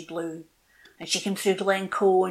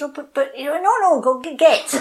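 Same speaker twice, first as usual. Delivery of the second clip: higher, louder stammering plea; a little bird begging not to be sent away; flustered pleading; 6 semitones higher, 8 dB louder than usual. Acted. Little robin begging the oak tree, a high flustered plea.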